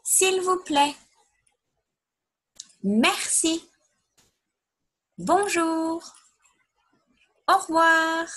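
teacher leading the class through the French words; French words, high. Shown as a demonstration. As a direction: model each word brightly, then pause for the class. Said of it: "let's say them all again together".

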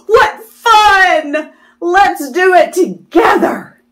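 gleeful tadpole voice, a touch of a cry; excited. Acted. Pollywog delighted at plan.

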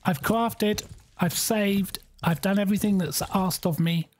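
ordinary talk

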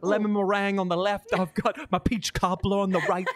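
high, loud put-on brag; hammed-up sassy tough talk. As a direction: strut it like a cocky baker. Mock boast, acting tough.

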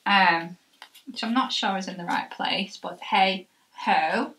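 speaking with jaw strapped; chin strap on. Real, not acted. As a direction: through tight chin band.

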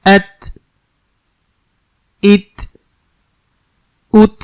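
এত ইত উত ending on a stopped ত with air; sakin ta. Shown as a demonstration. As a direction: close each on a breathy ত. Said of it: "আমার সাথে সাথে আপনারা বলার চেষ্টা করবেন". (সাকিন ত বাতাস সহ)

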